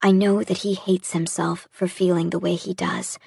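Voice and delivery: ordinary delivery, nothing marked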